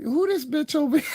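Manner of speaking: high-pitched imitation of indignant aunts; aunts' voices. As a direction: mimic your aunts in a high voice.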